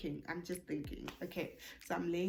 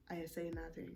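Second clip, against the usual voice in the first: ordinary delivery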